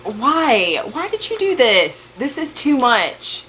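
high-pitched, disbelieving plea; overwhelmed. Real, not acted. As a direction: wail it at a too-generous friend. Overwhelmed by friend's surprise gift.

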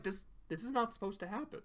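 bewildered fear building to a squeal; terrified confusion. Retold or imitated. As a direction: start hushed and rushed, end in a shriek.

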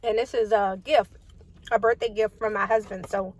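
talking between bites; chewing. Real, not acted. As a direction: mouth full while talking. Seen 4: Fork to mouth just before; closed, working jaw while holding the food tray.

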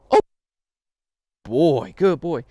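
loud, rising delighted praise; excited puppy praise. Shown as a demonstration. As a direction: cheer for a puppy who got it right. Demonstrates praise as puppy relieves himself.

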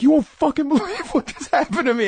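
can't-believe-it outburst to friends; excited disbelief. Retold or imitated. Telling roommates the story, high excited outburst.

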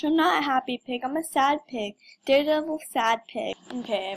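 ordinary talk, nothing marked

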